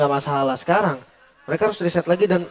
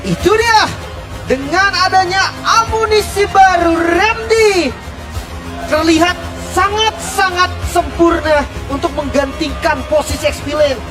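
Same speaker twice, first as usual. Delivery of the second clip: high, loud, excited hype call; fighting game announcer; 9 semitones higher, 3 dB louder, slower than usual. Real, not acted. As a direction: ring out the victory. Post-win hype call.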